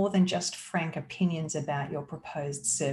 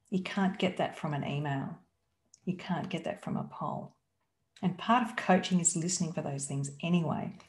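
ordinary delivery

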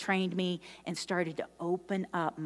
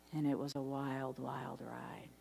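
hushed wonder; 6 semitones lower, 12 dB quieter than usual. Real, not acted. Reflective hush in sermon.

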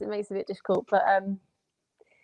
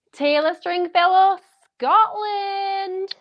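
high, slow contestant introduction; pageant announcement; 8 semitones higher, much slower than usual. Retold or imitated. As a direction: call out name and country, high and drawn out. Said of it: "and it was always".

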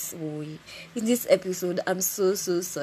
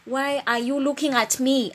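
higher, louder indignant demand; exasperated retold outburst; 5 semitones higher, 6 dB louder than usual. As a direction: throw it out like a fed-up challenge. Retelling reaction to a stare.

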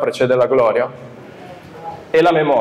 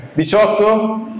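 surprised; 7 semitones higher, much slower than usual. Pretend. Mock bidding, surprised bid.

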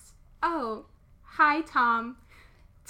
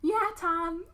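weird voice; 4 semitones higher than usual. Acted. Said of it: "I'm just gonna do a weird voice for them".

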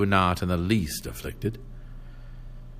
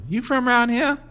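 put-on much higher, sharp old country woman. A narrator's reading. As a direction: fire it off like a nosy old widow. Old woman voiced in story.

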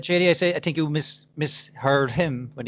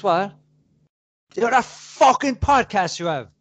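loud, higher-pitched aggressive demand; stressed, angry coworker; 7 semitones higher, 6 dB louder than usual. Retold or imitated. Angry coworker challenging the podcaster, aggressive. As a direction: imitate a worked-up man, loud and high. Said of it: "most stressed fucking men in the plant".

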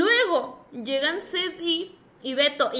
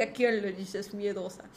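slow, low, quiet challenge; low and slow, daring them to repeat it; fiery defiance; 7 semitones lower, 7 dB quieter, slower than usual. Retold or imitated. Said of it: "prendí así como que todo mi fuego de Aries". (desafiante, lento y grave)

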